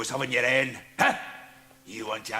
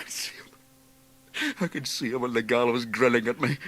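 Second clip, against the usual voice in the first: dread; 5 dB louder than usual. Acted. Jekyll terrified of hanging.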